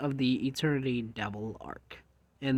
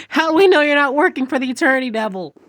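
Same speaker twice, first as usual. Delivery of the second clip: fast, high, loud accusation; I'm like; 10 semitones higher, 8 dB louder, faster than usual. Retold or imitated. Accusing Kobani of working for the devil, loud and high. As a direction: fire the question at her. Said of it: "I'm like".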